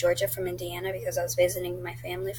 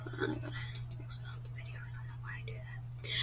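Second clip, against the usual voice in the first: very soft unvoiced mutter to herself; faint whispered aside; 18 dB quieter than usual. Real, not acted. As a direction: whisper it off-mic while fiddling. Whispered aside while clicking.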